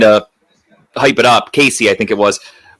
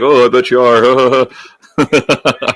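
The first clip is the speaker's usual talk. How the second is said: suggestive teasing; much slower than usual. Retold or imitated. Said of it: "everyone's just gonna be like".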